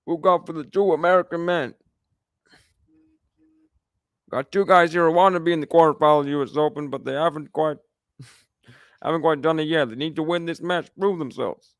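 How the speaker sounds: bad attempt at a McEnroe accent, brisk after the name; badly faked McEnroe accent, commentating on the match; John McEnroe. Streamer mimicking a commentator on two American men, bad McEnroe accent. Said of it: "that was my best McEnroe".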